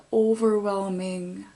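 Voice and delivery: normal talking voice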